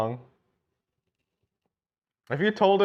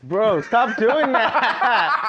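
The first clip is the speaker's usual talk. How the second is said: laughing protest; cracking up mid-shout; 7 semitones higher, 4 dB louder, much slower than usual. Real, not acted. Laughing at prank.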